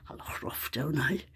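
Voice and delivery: soft whispered aside. Real, not acted. After signing off, whispering about her looks. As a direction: whisper it to yourself.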